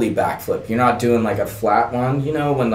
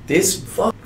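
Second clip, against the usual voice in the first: ordinary talk, nothing marked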